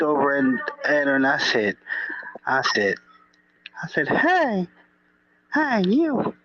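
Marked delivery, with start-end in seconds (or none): 4.1-6.3 high, sing-song calling out; mock sweet call. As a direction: hail her in a sugary high voice. Calling out to a woman at the table.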